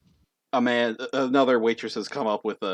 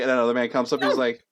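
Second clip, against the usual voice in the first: usual speaking voice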